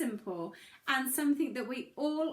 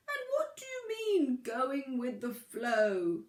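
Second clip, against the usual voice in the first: slow, drawn-out whale question; puzzled big whale, taking its time; slower than usual. A narrator's reading. Whale character asks.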